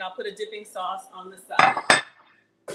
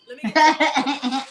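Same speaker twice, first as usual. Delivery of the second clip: laughing; 4 semitones higher, 10 dB louder, much slower than usual. Real, not acted. Laughing mid-sentence.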